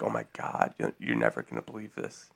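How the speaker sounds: a hushed, secretive tone. Retold or imitated. Teasing a Simpsons-obsessed friend, hushed. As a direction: hush it like spilling big news. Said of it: "I would just be like".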